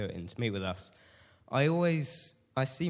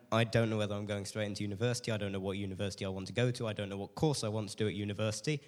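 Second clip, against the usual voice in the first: fast, run-on list of unknowns; speaking really quickly; faster than usual. Real, not acted. Speaker listing uncertainties about university, speaking really quickly. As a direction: rattle it off without a breath. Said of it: "I'm speaking really quickly".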